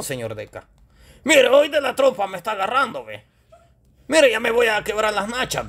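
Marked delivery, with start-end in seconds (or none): 1.2-5.7 high, pleading comic puppet voice; high-pitched mock alarm; complaining puppet shout. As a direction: cry out in protest in a high character voice. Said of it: "Grandes gritos que pega usted".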